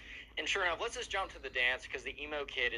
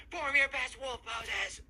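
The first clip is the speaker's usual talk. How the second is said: much higher and louder, slow commanding yell; hammed-up shouted order; 12 semitones higher, 4 dB louder, much slower than usual. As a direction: bellow the order like a drill sergeant of wolves. Emo kid orders wolf poses.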